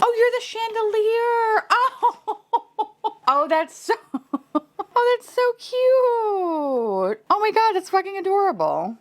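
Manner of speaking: squealing high over a surprise toy; laughing squeal. Real, not acted. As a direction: shriek with delight and laugh. Discovers the chandelier figure.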